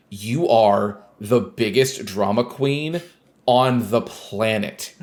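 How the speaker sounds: slow, word-by-word exasperation; exasperated emphasis. Real, not acted. Scolding Otacon as a drama queen, slow and exasperated. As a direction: spell it out slowly, pausing for emphasis.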